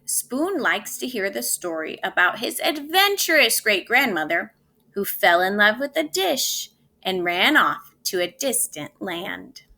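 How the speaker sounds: ordinary talk, nothing marked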